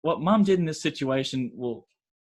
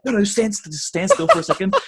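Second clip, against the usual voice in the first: higher, louder, panicky stalling; retold urgent plea; 6 semitones higher, 5 dB louder than usual. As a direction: act out frantically telling someone to hold still.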